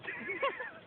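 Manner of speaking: short high-pitched laugh; burst of laughter. Real, not acted. Roaster after own jab, high burst of laughter.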